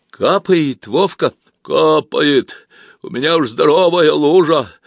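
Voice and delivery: loud slow urgent shout; shouting from the depths. Acted. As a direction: yell for help from below. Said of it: "кричал Вовка из глубины".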